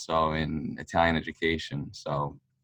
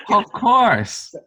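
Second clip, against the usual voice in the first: higher, louder eager "of course"; eager yes; 8 dB louder than usual. Retold or imitated. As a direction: jump at the offer like a thrilled fan. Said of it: "I said".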